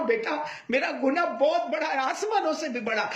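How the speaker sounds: high, strained weeping plea; weeping father. Acted. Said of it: "باپ اور زور سے رونے لگا".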